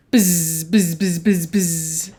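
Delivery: string of zizzing bee buzzes; bee noise. Shown as a demonstration. Leading children in a bee noise, repeated buzzing. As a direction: buzz like a swarm, holding each z. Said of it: "make a bee noise".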